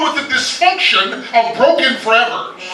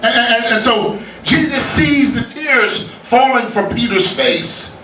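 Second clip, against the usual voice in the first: usual speaking voice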